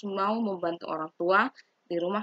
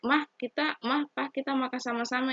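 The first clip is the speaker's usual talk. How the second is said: put-on higher, sing-song child calling Mah and Pa; sweet child inviting parents; 7 semitones higher than usual. Shown as a demonstration. Teacher acting a child calling parents to the table, sweetly higher. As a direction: play the child coaxing parents to eat together. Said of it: "Atau enggak".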